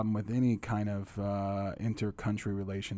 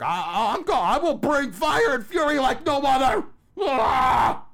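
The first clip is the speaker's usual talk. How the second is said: much higher, loud mock Trump bluster ending in a shout; hammed-up Trump rant; 10 dB louder than usual. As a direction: parody a shouting president on TV. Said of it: "He has to get on T V".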